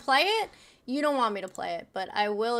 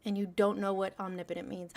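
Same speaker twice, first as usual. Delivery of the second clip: usual speaking voice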